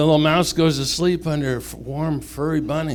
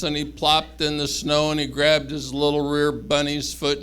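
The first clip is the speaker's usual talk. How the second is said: ordinary delivery, nothing marked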